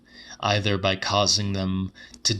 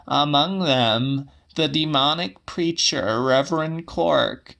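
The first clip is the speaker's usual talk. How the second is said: put-on an old woman explaining the vanished preacher, a little higher; 6 semitones higher, 3 dB louder than usual. A narrator's reading. Old woman correcting the man who insulted her, voice pitched higher.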